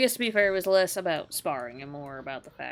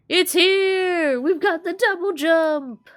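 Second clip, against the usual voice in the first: much higher, loud cheer; real thrilled shout; excited; 11 semitones higher, 7 dB louder, slower than usual. Real, not acted. Unlocks double jump.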